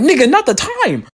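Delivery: high, exasperated comic retort; fed up with mom; a high, fed-up mock snap. Snapping at mom.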